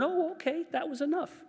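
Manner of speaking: put-on a small child's pleased little discovery; baby's realization. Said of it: "then I learn".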